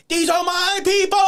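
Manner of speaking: high, loud lisped mock shout of "These are my people"; severe lisp. Opponent busts through to the crowd, lisped shout. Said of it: "he had a very severe lisp".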